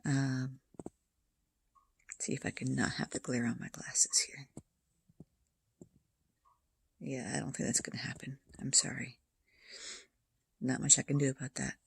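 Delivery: soft hushed whisper; quiet at night. Real, not acted. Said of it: "I don't wanna speak too loudly".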